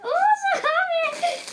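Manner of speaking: high, loud, laughing outburst; excited happy squeal. Real, not acted. Vlogger overjoyed with the mermaid tail, excited squeal. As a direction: burst into a giddy, high squeal. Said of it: "I'm gonna be so excited".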